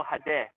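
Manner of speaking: Hades said HAH-deh, open first vowel, no final s; European pronunciation. Shown as a demonstration. Joking to viewers about the brand, says Hades the European way. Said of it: "say it the European way".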